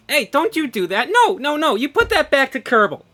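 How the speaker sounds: loud, high, rapid-fire protest; playful scolding. Real, not acted. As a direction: yell at a meddling friend to stop. Scolds moderator for changing stream.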